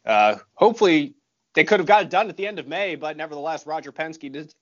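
normal talking voice

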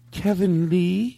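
"Kevin Lee" high, slow, rising at the end; questioning take. Shown as a demonstration. Another question take of the name.

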